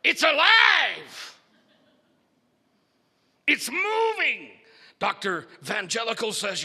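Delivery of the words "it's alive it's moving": high, loud and slow; excited shout. Acted. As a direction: drag out the amazement loudly. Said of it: "shouted Igor".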